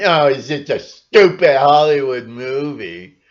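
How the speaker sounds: loud, scoffing dismissal; mock dismissive sheep. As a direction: brush it off like a know-nothing. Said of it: "Or are you just going".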